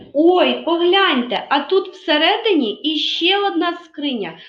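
high, loud oh-look reaction; staged wonder at a new find; playful surprise. Pretend. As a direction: act amazed for the children. Teacher discovers a second chest.

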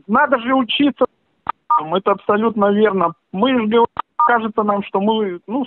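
ordinary delivery

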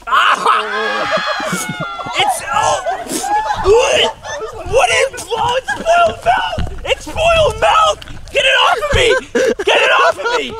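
high, loud panicked shrieking; screaming in disgust. Real, not acted. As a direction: shriek to get it off you. Hit by a spoiled milk balloon.

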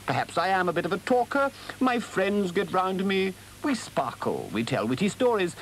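high, airy Toad with a little laugh; vain, chatty Toad. Acted. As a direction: chatter on in a high voice, pleased with yourself.

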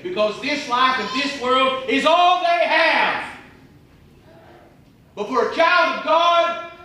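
high, slow and loud, driving the point home; fervent preaching. Real, not acted. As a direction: lift the voice and stretch it out. Preacher building to emphasis.

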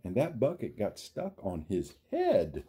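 lively, sing-song storybook read; reading to children. Acted. Reading a picture book aloud to young viewers, sing-song. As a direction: build each chain link like a bedtime story.

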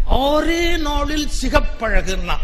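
slow, drawn-out sales pitch voice; mocking TV ad. Speaker mocks a TV fairness-cream ad in a slow ad voice. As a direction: parody a cheesy cream ad. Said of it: "ஒரு விளம்பரம் போட்டு கெடுக்கிறான்".

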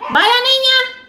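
very high, loud holler; calling out. Real, not acted. Cook hurrying the girl to the plate, high loud call.

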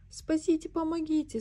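high thin squeak, pleading; squeaky cry for help. A narrator's reading. Piglet trapped under Pooh squeaks for help, high and thin. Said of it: "снова пискнул голосок".

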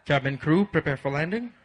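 pilot intercom announcement. Retold or imitated. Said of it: "He went on the intercom and said".

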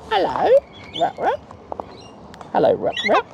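very high chirpy greeting; squeaky-high pet voice; calling pet bird. Real, not acted. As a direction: pipe it up high to a little bird.

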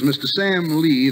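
usual speaking voice

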